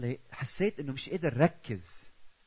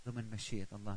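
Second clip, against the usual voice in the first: ordinary talk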